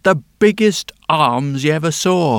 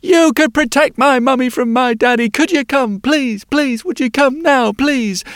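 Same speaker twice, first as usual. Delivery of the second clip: put-on high pleading child voice, a little louder; begging little girl; 7 semitones higher, 4 dB louder than usual. Girl begging blacksmith to come now, high pleading. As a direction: keep asking, a small girl pleading.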